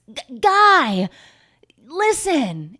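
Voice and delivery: high, loud pleading call; hammed-up lover's shout; mock calling out. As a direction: call after him theatrically. Retold Miranda calling out to him.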